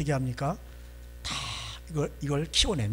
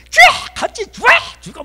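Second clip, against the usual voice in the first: very high, explosive shrieks; furious shouting; 14 dB louder than usual. Acted. Rachel lashing out at her husband, furious shrieking. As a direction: scream it with no restraint. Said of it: "이게 겸손한 표현이 아니에요".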